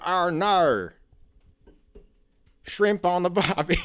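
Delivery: loud, high mock Australian accent; hammed-up Australian twang, pushed loud. Mocking how the rapper talks, broad Australian accent. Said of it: "[name] is a big one ... she raps way differently than she fucking talks".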